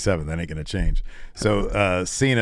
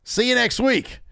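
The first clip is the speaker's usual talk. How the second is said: high mock challenge; 10 semitones higher than usual.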